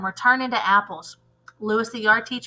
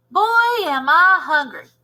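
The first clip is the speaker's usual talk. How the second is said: much higher, louder cheerful exclaim; hungry art teacher; 6 semitones higher, 6 dB louder, slower than usual. Acted. Lewis to himself, cheerful exclamation. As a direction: announce hunger with gusto.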